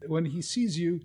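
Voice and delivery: usual speaking voice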